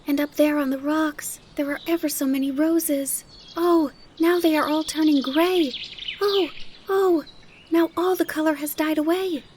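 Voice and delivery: put-on high little-girl voice, wonder turning to dismay; high-pitched, exclaiming child; excited child Heidi. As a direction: marvel aloud, then grow distressed.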